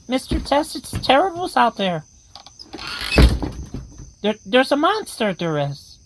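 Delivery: a tin soldier warning of a monster outside; toy soldier voice. Acted. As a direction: squeak it out like a frightened toy. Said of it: "the ones with the terrible accents".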